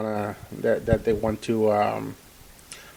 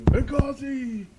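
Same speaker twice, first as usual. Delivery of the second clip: high, drawn-out panicky wail; hammed-up shrieky alarm without full volume; mock hysterical screaming; 7 semitones higher than usual. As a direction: parody an outraged mob baying after its target. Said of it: "they're going to scream".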